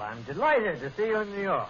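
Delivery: usual speaking voice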